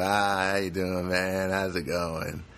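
a lightly voiced, slow, laid-back upstairs guy; just a hint of an easygoing dude greeting you; mellow neighbor. Upstairs neighbor greeting him in the hall, lightly voiced mellow dude. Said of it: "he's kinda got this mellow kind of demeanor".

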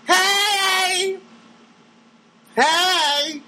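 high, drawn-out goofy greeting; Jerry Lewis voice. Retold or imitated. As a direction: stretch it out high like a manic slapstick comic. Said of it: "Won't sound like Jerry Lewis".

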